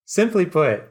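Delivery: high steady smooth boss voice; composed villain. Acted. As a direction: retake it straight as the boss. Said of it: "trying to get him, like, hey, so hard".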